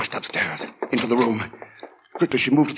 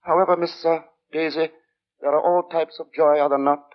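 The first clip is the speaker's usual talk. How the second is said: sinister lodger; 8 semitones higher than usual. Acted. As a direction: speak like a polite man hiding menace. Narrator voices the lodger Sleuth.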